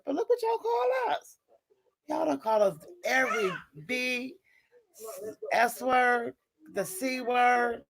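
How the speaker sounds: real rising outrage at the name-calling; heated outburst. Real, not acted. Venting at men in live chat.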